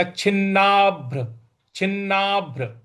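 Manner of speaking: छिन्नाभ्र with the भ pressed hard; stress on bh. Shown as a demonstration. Teacher demonstrating the stressed भ. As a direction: put force on the भ in छिन्नाभ्र. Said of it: "बल लगाना है".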